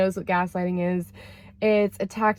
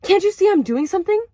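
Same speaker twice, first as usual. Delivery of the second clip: higher, louder, fast irritated retort; irritated snap; 10 semitones higher, 5 dB louder, faster than usual. Retold or imitated. Ex-partner rebuffing her hug, a fast annoyed snap. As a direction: snap back, annoyed at the interruption. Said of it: "I still remember the tone of his voice".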